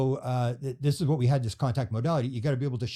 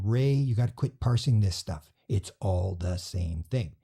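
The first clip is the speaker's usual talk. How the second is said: slow wise beings; 4 semitones lower, slower than usual. Retold or imitated. Beings speaking to Ray.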